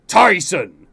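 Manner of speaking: booming angry yell. A narrator's reading. King waking his son, booming angry yell. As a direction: bellow it like an enraged king. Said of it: "father's booming voice angrily yell your name".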